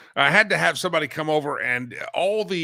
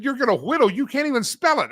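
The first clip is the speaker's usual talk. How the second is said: higher, mock-disbelieving question; incredulous; 8 semitones higher than usual. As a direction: tease the boy's big plan. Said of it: "I said".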